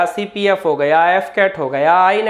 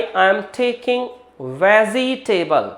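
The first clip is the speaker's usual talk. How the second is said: slow demo; much slower than usual. Shown as a demonstration. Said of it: "गलत वाला pronunciation".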